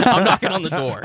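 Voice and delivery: laughing through the words; giggling while repeating it. Real, not acted. Laughing repeat of own line.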